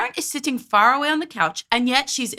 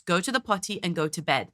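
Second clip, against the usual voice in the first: lower, softer imitation of a firm mother; clear, calm instruction; 4 semitones lower, 5 dB quieter than usual. Commentator voicing a mother to her tired son, clear and calm. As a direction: kind but firm, lightly acted. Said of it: "very clear on what he needs".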